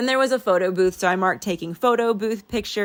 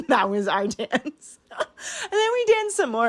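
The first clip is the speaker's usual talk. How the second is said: tearful, slow, voice climbing high; laugh-cry the words out slowly; about to cry; 6 semitones higher, slower than usual. Real, not acted. Remembering the dance with sister, about to cry. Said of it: "I'm gonna cry right now".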